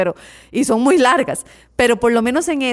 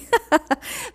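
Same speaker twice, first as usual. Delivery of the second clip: short, higher-pitched laugh; amused laugh; 4 semitones higher than usual. Real, not acted. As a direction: break into a laugh. Se ríe de su propia reacción. (risa divertida)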